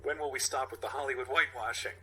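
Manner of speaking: normal talking voice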